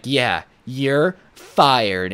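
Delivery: very slow, deliberate delivery; drawn-out mock firing. Manager staging a firing for the customer, slow and deliberate. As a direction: stretch out the verdict for show.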